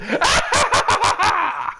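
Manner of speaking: high shouted villain cackle; Joker laugh. Shown as a demonstration. Finishing the Hamill Joker, shouted high cackle. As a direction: throw out a big cartoon villain laugh. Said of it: "I can try Mark Hamill's".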